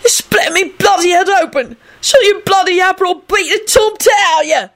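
high, screamed British threat; threatening. A narrator's reading. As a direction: shriek the threat in outrage. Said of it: "he finished threateningly".

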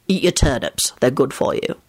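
mildly firm, low instruction; stern. A narrator's reading. Father telling boy to eat turnips, stern. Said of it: "Lupin told Teddy sternly".

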